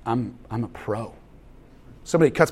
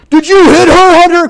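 mock scolding; 14 semitones higher, 12 dB louder than usual. Dad scolding son in story.

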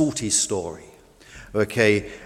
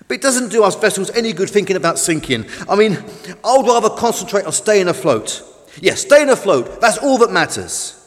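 higher, insistent little boat voice; earnest tugboat; 6 semitones higher, 5 dB louder than usual. Acted. Tug tells Fish to think about staying afloat, insistent. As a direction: reason firmly with a gloomy friend.

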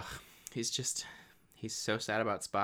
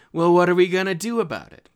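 loud, higher indignant demand; challenging officer; 6 semitones higher, 10 dB louder, faster than usual. Acted. Acting an officer confronting Admiral Kirk, loud and indignant. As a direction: confront him, voice raised.

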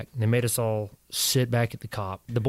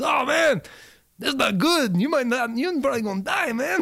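put-on high, breezy old sergeant saying it plainly; hammed-up cheerful doom; mock cheery sergeant; 14 semitones higher than usual. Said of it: "he goes".

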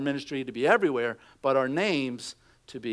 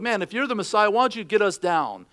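higher and louder, jeering at the man on the cross; mock railing taunt; 7 semitones higher, 5 dB louder than usual. Thief on the cross taunting Jesus, louder jeering. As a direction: sneer it like a condemned thief.

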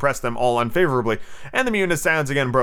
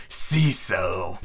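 drawn-out, low steely villain voice; full pirate captain voice, stretching the name; Hook; 5 semitones lower than usual. Shown as a demonstration. Hook addressing his pirate, in full steely character. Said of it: "in the proper Hook voice".

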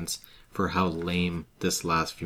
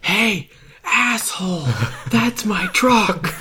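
high, slow drawn-out laughing yell; slow mock angry yell; 13 semitones higher, 6 dB louder, much slower than usual. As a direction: yell it like an annoyed tourist. Acting Jeff yelling at tow driver.

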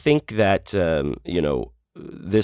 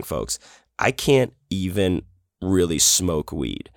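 normal talking voice

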